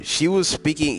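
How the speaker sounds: ordinary talk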